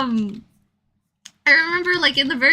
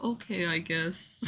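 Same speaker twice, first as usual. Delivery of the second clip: much softer, resigned mumble; Shuichi meekly; 4 semitones lower, 10 dB quieter than usual. Retold or imitated. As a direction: give in, barely audible. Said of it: "he'll be like".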